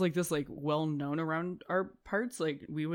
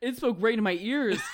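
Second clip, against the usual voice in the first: higher, louder astonished cry; amazed exclamation; 6 semitones higher, 5 dB louder than usual. Acted. Player in character marvels at the talking wolf, loud amazement. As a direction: blurt it out in playful wonder. Excited correction after hearing the wolf.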